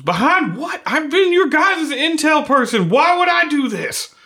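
high, loud desperate protest; plead, wounded and shocked; pleading innocence. Acted.

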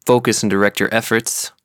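ordinary talk, nothing marked

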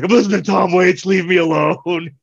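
mock sulky brush-off. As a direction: play the sulking drunk pushing him away. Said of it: "And I'm like".

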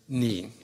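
ni pronounced as a long "nee"; ni set apart as a drawn-out "nee"; shown first syllable. Acted. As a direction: say ni slowly, like "knee". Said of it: "the first syllable in English was pronounced".

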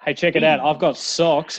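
ordinary delivery

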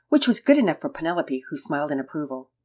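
ordinary delivery

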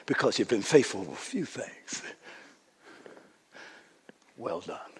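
a quiet, tender blessing; God's gentle praise. Retold or imitated. God praising a faithful man, soft and tender. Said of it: "God is saying".

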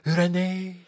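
high, loud drawn-out call; put-on, high-pitched sitcom cry; sitcom catchphrase impression. Mimics Hello Hello sitcom catchphrase.

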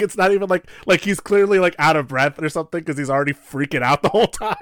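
usual speaking voice

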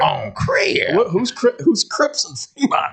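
exaggerated, high caller catchphrase; mock caller impression. Friend repeats the caller's catchphrase, hammed-up mockery. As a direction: draw out the catchphrase in a put-on voice. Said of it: "My favorite part was when he said".

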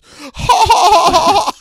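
high loud goofy laugh; ha-ha like a cartoon kid. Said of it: "the way he laughed".

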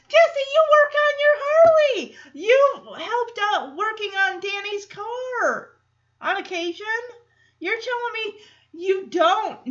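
high, loud, laughing disbelief; incredulous. Real, not acted. Speaker ribbing Jesse about the car, incredulous and laughing. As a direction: call him out with a laugh.

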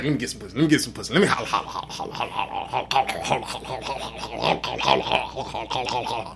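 low, fast mumbled pestering; a nagging guy begging on and on; lightly voiced pestering dude; mocking pushy guy.

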